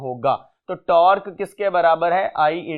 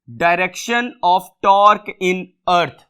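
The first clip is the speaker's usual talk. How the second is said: slow dictation; much slower than usual. Shown as a demonstration. Reads MCQ statement aloud.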